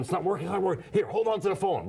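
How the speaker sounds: high, panicky rush of words; mock panic, the PA system failing; freaking out. Ed Meese panicking over the broken phone hookup, mock frantic. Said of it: "he's, like, freaking out".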